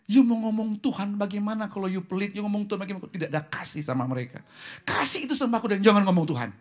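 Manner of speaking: high, loud, rapid rebuke; scolding exhortation. Real, not acted. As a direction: press the congregation hard, rushing the words. Scolds stingy believers from pulpit. (teguran keras, cepat, dan lantang)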